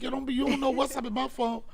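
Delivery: high-pitched defensive retort; comic defensive objection; retold protest. Retold protest over phone being seen.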